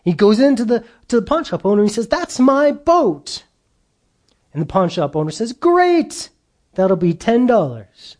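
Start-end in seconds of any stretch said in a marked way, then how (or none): 2.1-3.4 put-on high, slow child's protest; indignant little boy. Boy telling the pawn shop owner the boat is his, high and slow. 5.5-8.2 high, chirpy salesman's greeting; cheery shopkeeper. Acted. Pawn shop owner asking the boy for money, high and chirpy.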